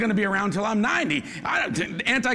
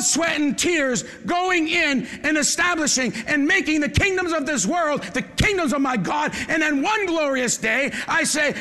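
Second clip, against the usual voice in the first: rising, fervent preacher build; preaching; 5 semitones higher, slower than usual. Acted.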